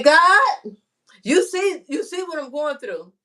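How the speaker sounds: high, loud plea to heaven, arms up; pleading cry to God. Retold or imitated. Complaining to God about her troubles, loud high plea. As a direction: call out to God so he hears your struggle.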